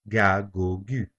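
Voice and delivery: "Ga, go, gu" each with a hard G as in go; hard G syllables. Shown as a demonstration. Teacher demonstrating the hard G before a, o, u. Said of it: "the G will be pronounced as G".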